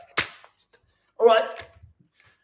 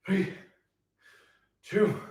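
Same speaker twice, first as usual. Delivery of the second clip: normal talking voice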